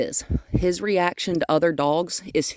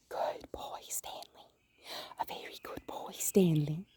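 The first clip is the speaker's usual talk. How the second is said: whisper; 13 dB quieter than usual. Real, not acted. Praising dog in whisper.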